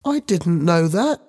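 put-on high-pitched, frightened boy. A narrator's reading. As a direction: plead innocence as a scared schoolboy. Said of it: "Ben said, frightened".

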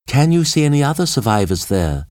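high female call across the wreck; high, carrying question. A narrator's reading. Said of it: "Jane called".

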